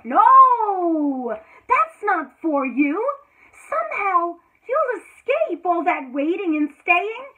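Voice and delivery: loud, sharp exclamation; emphatic no. Acted. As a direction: break the spell with a loud no. Sudden turn in reading.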